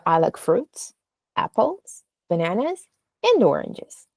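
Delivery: "fruits", "apples", "bananas" rise, "oranges" falls; rising list closed with a falling "oranges"; list going down last. Shown as a demonstration. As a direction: pause between items, drop on "oranges". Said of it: "maybe oranges can go down".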